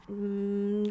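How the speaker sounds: ordinary talk, nothing marked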